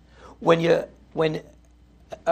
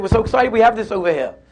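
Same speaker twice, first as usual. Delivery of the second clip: retold excitement, high and loud; excited; 5 semitones higher, 7 dB louder than usual. Prisoners telling the guard about the toilet, excited. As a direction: gush it like an eager prisoner. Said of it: "What are you so excited about?".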